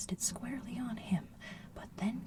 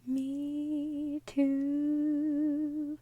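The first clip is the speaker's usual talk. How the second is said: a hint of a small child's voice; young kangaroo; 5 semitones higher, 11 dB louder than usual. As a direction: chirp it like a tiny joey. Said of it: "the young kangaroo in her pouch said".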